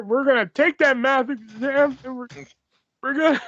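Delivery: high, halting wrestler promo; Matt Hardy. Acted. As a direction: stumble through a high-pitched promo voice. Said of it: "I'm Matt Hardy again".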